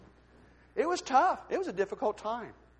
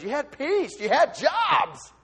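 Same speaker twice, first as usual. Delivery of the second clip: normal talking voice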